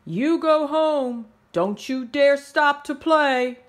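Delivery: lightly voiced bossy head teacher; stern principal. A narrator's reading. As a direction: scold the kids like a strict principal. Principal orders kids home.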